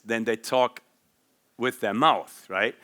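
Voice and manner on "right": ordinary talk, nothing marked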